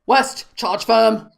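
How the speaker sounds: loud, slow barked command; shouted order. A narrator's reading. Officer shouts orders to his troops, loud. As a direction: shout the order across the street. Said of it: "Someone shouted an order".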